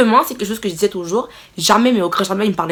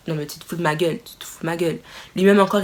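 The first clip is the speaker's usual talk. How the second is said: usual speaking voice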